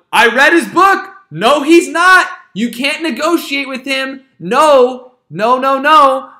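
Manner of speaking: loud, high, slow insistent protest; loud, slow mock Churchill warning Parliament; Churchill shouting. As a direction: hammer it at Parliament, no negotiating. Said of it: "Churchill's in Parliament going".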